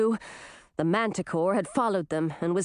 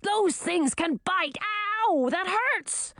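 high, slow yowling complaint; pained monster; 8 semitones higher, slower than usual. A narrator's reading. Manticore bitten by fire ants, pained yowling. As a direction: whine at the stinging bites, pitched up.

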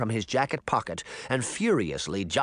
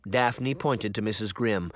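plain reading voice

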